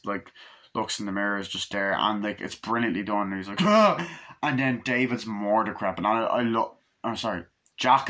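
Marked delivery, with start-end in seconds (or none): 3.6-4.0 sudden high, loud gasping "ah"; startled cry. Retold or imitated. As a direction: jolt out a short high yelp. Startled shout in retold film scene.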